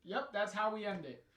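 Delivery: high, loud laughing wrap-up. Real, not acted. Player laughing at the game's ending, high and loud.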